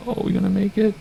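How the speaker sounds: high, sudden worried question; tense surprise. Real, not acted. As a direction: gasp it out, unsure you'll fit. Squeezing past vehicle on taxiway.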